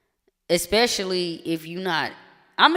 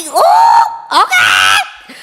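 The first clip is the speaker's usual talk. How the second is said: excited shout; 13 semitones higher, 12 dB louder, much slower than usual. Real, not acted. Reacting to the song starting.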